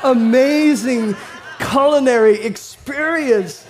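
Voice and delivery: high, loud, laughing rave about the taste; laughing gush. Pretend. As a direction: build to a laughing shout. Toddler's voice continues, laughing.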